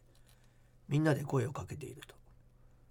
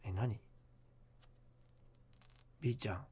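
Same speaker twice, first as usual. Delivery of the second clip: hushed creepy ghost story; 3 semitones lower than usual. Acted. Reads listener ghost letter.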